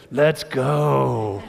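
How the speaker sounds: loud, drawn-out rallying cry; kids' hype cheer. Retold or imitated. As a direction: imitate excited kids cheering to fire up a crowd. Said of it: "as my kids like to say".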